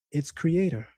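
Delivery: ordinary talk, nothing marked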